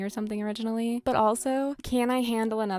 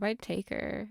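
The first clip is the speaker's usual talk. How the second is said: ordinary talk, nothing marked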